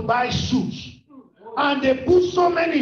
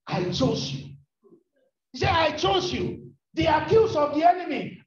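impassioned preaching in the voice of Jesus; fervent sermon climax over music; full-force preaching; 4 semitones higher than usual. Acted.

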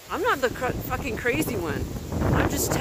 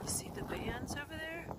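much softer, hushed question; quiet; 5 semitones higher, 15 dB quieter than usual. Real, not acted. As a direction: point it out under your breath. Quiet filming of sky.

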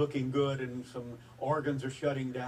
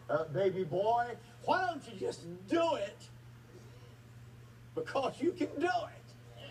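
building from firm pleading to a much higher, desperate cry; frustrated pleading; 5 semitones higher than usual. Retold or imitated. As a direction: demand it of God, climbing higher with every line. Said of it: "this is the way I kinda said it because I'm getting frustrated".

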